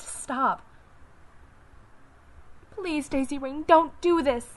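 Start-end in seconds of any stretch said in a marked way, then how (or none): 2.7-4.6 rising loud plea; desperate plea. Acted. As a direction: beg her to stop. Inner plea, desperate cry.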